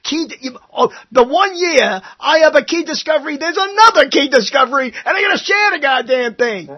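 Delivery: high-pitched mock outrage at sharing; imagined grumbling laureate. Podcast host imitates a laureate complaining, mock outraged. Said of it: "Mister Richardson sitting there going".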